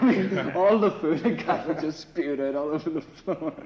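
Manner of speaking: laughing, slow and loud; real laughter. Real, not acted. Telling the interviewer about the beating, laughing. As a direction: laugh through the grim punchline.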